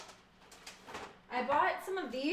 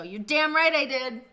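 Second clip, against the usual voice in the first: cocky; 4 semitones higher, 10 dB louder than usual. Pretend. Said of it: "turned into a gun show".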